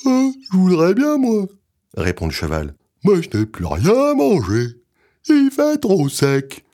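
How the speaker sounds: high, loud whinnying horse. Acted. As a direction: speak like a hungry old horse. Horse answers.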